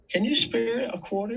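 high, timid beggar's plea; pitiful beggar. Retold or imitated. Panhandler asking the rich couple, high and pitiful. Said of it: "they're coming by and go".

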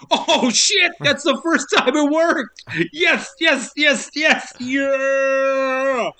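high, shouted yes yes yes; excited cheer. Retold or imitated. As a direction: cheer like a giddy kid whose trick worked. Voight cheering that the wasp worked.

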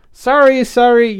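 high, loud repeated apology; hurried apology. A narrator's reading. Youngmi's phone goes off mid-meeting, hurried apology. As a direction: blurt the apology over an interruption.

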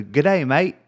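stock Australian greeting; easy go-to Australian impression; Australian accent. Shown as a demonstration. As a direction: put on an Australian accent, voice lifted. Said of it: "Give me your best Australian accent".

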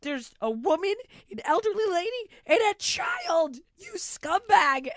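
angry. Retold or imitated. Mom berating a man in line, high and loud. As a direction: spit each word at the scumbag. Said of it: "I'm like".

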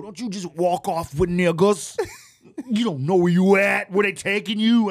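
loud, high scolding; imitating frustrated Ali. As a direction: tell off a wandering friend at full volume. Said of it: "He was so frustrated with me".

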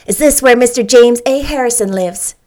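quick, bright little lady asking; brisk question. Acted. Little lady asking Anne at the gate, brisk. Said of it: "she inquired briskly".

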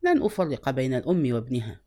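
low-pitched, understated coaxing; enticing. A narrator's reading. Lady promises to keep mother and son together, mildly enticing. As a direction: gently tempt him with the offer. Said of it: "فقالت بإغراء".

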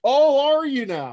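high, loud teasing disbelief; mock surprise. Real, not acted. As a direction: tease him for false modesty. Teasing doubt of his modesty.

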